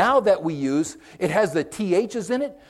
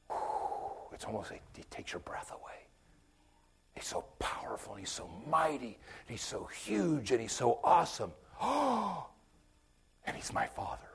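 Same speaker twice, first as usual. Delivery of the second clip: breathless whisper full of awe; awed whisper; 5 semitones lower, 14 dB quieter than usual. Real, not acted. Preacher overwhelmed by God's might, breathless whisper. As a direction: let the awe take your breath away, whispering.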